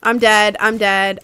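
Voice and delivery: normal talking voice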